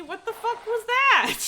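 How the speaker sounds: high, loud, slow what the fuck was that; shocked by a sudden jump scare; startled outburst. Real, not acted. Startled by something in game.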